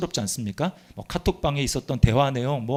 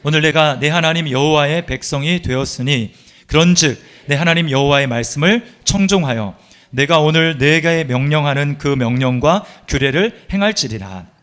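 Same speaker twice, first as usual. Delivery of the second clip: louder, steady unison reading; scripture read aloud together; 6 dB louder than usual. Acted. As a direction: lead the congregation through the verse. Congregation reads scripture together.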